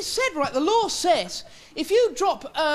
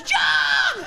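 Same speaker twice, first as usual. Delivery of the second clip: loud high call of John; shouted name; 13 semitones higher, 5 dB louder than usual. Retold or imitated. A stranger shouting to him across the street, loud. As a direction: yell the name across the road. Someone calls you.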